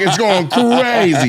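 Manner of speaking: ordinary talk, nothing marked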